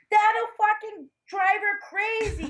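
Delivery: high, exaggerated mock shout; father's outburst. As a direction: belt out the dad's retort, drawn out. Father's retort voiced in story.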